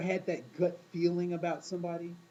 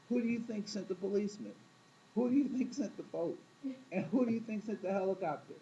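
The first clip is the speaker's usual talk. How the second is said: God's rebuke; 4 semitones higher than usual. Retold or imitated. God answers the man.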